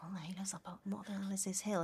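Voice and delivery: very soft, whispery aside to yourself; muttered grumble. Retold or imitated. Actor re-enacting an improvised rehearsal moan about the hill, muttered very softly. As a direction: grumble under your breath, barely voiced.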